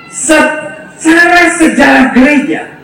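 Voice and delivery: usual speaking voice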